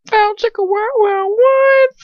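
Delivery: very high goofy squeal; mock-excited squawk; silly hyped riff. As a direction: squeal it like a cartoon catcall. Goofy riff on sexier story.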